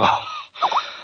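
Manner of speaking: heavy huffing exhale, out of breath; winded huff. Acted. Game master acting a winded old man's huff. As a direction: puff as if winded from hauling someone. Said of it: "starts to bend forward and go".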